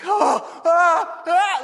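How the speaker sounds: high, loud mock fright; scared gasps. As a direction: gasp like you expect lightning. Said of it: "we're like".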